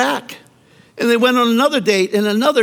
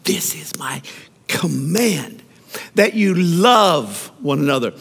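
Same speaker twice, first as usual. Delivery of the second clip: whispered command; 3 semitones lower than usual. Shown as a demonstration. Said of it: "No, no".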